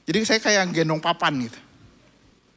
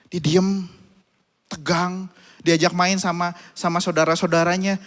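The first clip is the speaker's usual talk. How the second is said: normal talking voice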